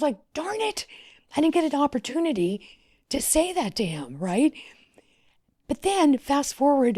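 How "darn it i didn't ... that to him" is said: retold frustration. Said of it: "I was so sad".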